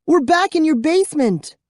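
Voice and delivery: high, bright joyful shout; girl crying out happily to her friend; happy cry. A narrator's reading. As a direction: burst out with relief and joy. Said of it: "she cried happily".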